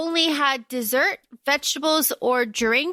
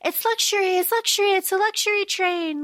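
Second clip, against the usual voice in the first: high, chirpy Effie sales pitch; Effie impression; 6 semitones higher than usual. Effie boasting to the tributes, high and chirpy. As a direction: sell the train like a giddy hostess, high.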